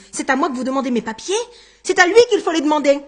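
rapid, rising indignant protest. A narrator's reading. Jérôme asked for his papers by police, indignant. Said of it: "s'indigna Jérôme".

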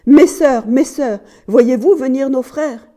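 high, urgent distant call; crying out. A narrator's reading. Wife crying out to her sisters on watch, urgently. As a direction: call desperately up to the ramparts. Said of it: "elle criait en direction des remparts".

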